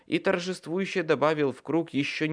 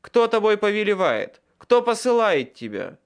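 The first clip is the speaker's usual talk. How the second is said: loud, higher demanding questions; commanding shout; 9 semitones higher, 8 dB louder than usual. A narrator's reading. Wizard interrogating a bound nightmare, commanding shout. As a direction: bark the questions at the creature.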